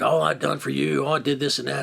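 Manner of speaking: high-pitched mock grievance; mocking complaint. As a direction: imitate a partner's self-pitying rant. Mocking partner's grievance in retold quarrel.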